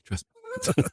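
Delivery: "Trust me." leaps way up on "me"; mock squeak. Mocking the dead character with a squeaky reassurance. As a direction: push "me" up into a squeak.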